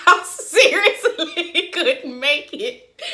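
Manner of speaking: slow, words broken by laughter; laughing. Real, not acted. Laughing at herself.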